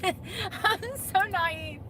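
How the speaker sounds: drawn out in high laughter, very slow; cracking up at herself; laugh-crying. Real, not acted. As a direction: laugh so hard it breaks.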